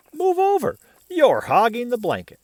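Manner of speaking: put-on high, loud bossy kid sister; big sister shoving for room under the blanket; squabbling sister. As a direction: complain like a squashed little girl.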